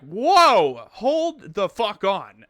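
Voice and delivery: loud, drawn-out retold protest; taken aback. Customer retelling his reply to a raging bartender, loudly taken aback.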